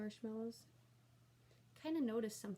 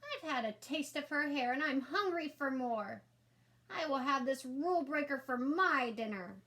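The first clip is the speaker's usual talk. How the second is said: higher, louder greedy creature voice; hungry tub monster; 5 semitones higher, 5 dB louder than usual. A narrator's reading. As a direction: crave her like a monster. Tub monster claims prey.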